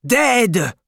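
put-on father crying out over his child again; cried out. A narrator's reading. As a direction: wail the word out. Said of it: "the tall man cried again".

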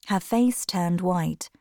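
plain reading voice

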